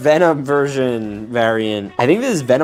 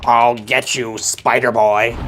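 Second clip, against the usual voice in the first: villain voice; 2 semitones higher than usual. Pretend. Villain taunts the hero.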